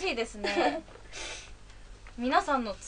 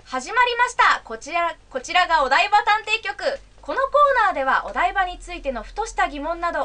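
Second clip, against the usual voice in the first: bright, raised corner-opening announcement; radio announcer; 3 semitones higher than usual. Acted. Host opening the Odaiba detective corner for listeners, announcer style. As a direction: open the segment like a radio host.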